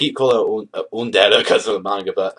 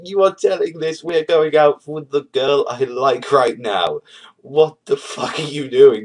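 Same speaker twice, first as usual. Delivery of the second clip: higher, louder disbelieving rant; mock-outraged boyfriend demanding answers; a hammed-up indignant protest; retold outburst; 5 semitones higher, 3 dB louder, slower than usual. Raku confronts Chitoge, outraged.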